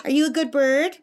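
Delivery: lifted, lilting pet-talk; praising a pet. Real, not acted. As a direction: coo praise at a pet bird. Praising her pet bird.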